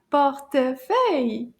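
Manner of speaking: "Portefeuille" in three even beats; syllable by syllable. Shown as a demonstration. Teacher confirming three syllables to class, counted beats. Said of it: "Trois syllabes".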